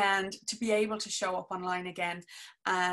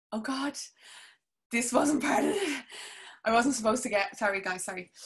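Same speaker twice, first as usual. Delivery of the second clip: real tears rising, trying to hold them in; fighting back tears; 3 semitones higher than usual. Real, not acted. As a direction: voice catching, pitched up and tight with emotion. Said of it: "I'm not gonna get emotional".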